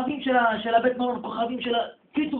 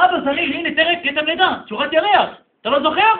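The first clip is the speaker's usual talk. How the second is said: higher and louder, begging to be known; urgent pleading; 9 semitones higher, 16 dB louder than usual. Acted. Son begs his father to remember him, urgent and loud. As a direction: show him the birthmark, pressing harder.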